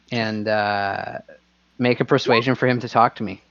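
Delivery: ordinary talk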